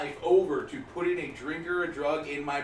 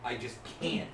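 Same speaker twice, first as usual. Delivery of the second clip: mom pleading; 4 semitones lower, 6 dB quieter than usual. Retold or imitated. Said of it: "my mom would be like".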